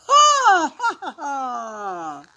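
hammed-up belly laugh; acted laughter. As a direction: let out a slow, descending ha-ha-ha. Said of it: "they laugh and laugh and laugh".

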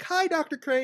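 very high, sweet, chirpy hello; sweet Harley greeting. Retold or imitated. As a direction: greet him like a cheerful friend. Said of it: "And she's like".

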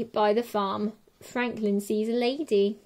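ordinary delivery

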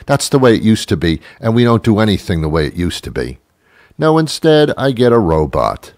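usual speaking voice

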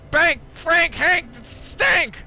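high, loud name list; excited shouting. Real, not acted. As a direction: shout each name gleefully. Reading names in excitement.

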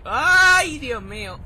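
long, high, loud cry of alarm; alarmed yell. Real, not acted. Gamer alarmed mid-game, loud high yell. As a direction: cry out as danger hits. Sudden alarm during play.

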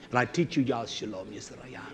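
usual speaking voice

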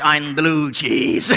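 low, very slow cheese character; blue cheese voice. Acted. Cheese answering the milk, low slow bluesy voice. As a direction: answer as doleful cheese, drawn out. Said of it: "Why do you sound like that?".

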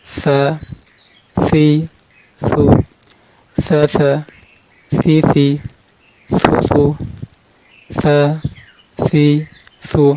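ফা, সি, সু with air released through the consonant; airy hams sound. Shown as a demonstration. Teacher drilling learners on hams, ফা, সি, সু with escaping air. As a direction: let breath flow out on the first sound of ফা, সি, সু. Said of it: "বাতাস বের করবেন, হামস করবেন".

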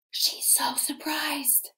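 gasp, then whispered awe; a gasped whisper, wide-eyed; surprised whisper. Shown as a demonstration. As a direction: breathe it out in hushed amazement. Teacher gasps at surprised picture.

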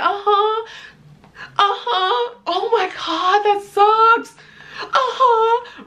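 high-pitched, hollow mock sympathy; imitated distracted friend. Friend texting while she cries, high feigned sympathy. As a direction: toss out high token listening noises.

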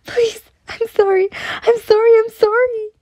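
very high, panicked apologies; desperate begging. A narrator's reading. Servant girl being dragged away by soldiers, desperate begging. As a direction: plead over and over in a high voice. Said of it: "the girl kept begging".

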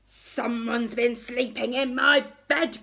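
loud, gruff bear growl; big outraged bear voice; growling Daddy Bear. A narrator's reading. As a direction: growl it out like an angry father bear. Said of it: "growled Daddy Bear".

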